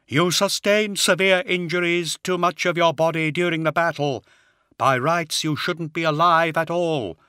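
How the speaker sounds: high, hollow unearthly voice; a death-faced brother, hollow and cold. A narrator's reading. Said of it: "in his hollow voice".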